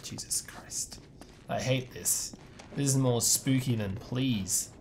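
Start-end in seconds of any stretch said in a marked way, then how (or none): 0.0-1.0 breathless scared whisper. Real, not acted. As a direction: whisper it in dread. Whispered in tense area.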